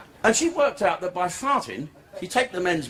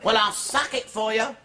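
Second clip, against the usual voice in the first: high put-on woman's voice; prostitute's offer; 6 semitones higher than usual. Prostitute haggles with Chalky, high put-on voice. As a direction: coax him in a comic female pitch.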